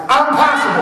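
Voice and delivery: pumped-up crowd call. Shown as a demonstration. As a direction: lift it and push it out to the crowd. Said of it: "Louder, guys".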